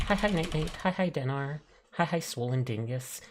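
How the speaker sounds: normal talking voice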